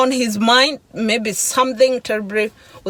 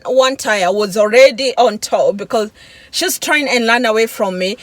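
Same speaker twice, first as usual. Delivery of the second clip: normal talking voice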